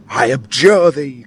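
high-flown lovelorn outcry; melodramatic cry. A narrator's reading. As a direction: cry it out slowly like a tragedy heroine. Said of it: "cries Tom's young lady".

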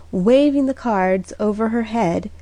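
plain reading voice

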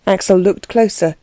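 usual speaking voice

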